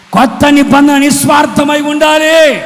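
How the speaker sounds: high, loud, full-force proclamation; shouted preaching. Real, not acted. Preacher rousing the congregation to be selfless, shouted preaching. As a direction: drive it home at the top of your voice. Repeats louder after applause call.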